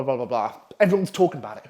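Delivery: ordinary delivery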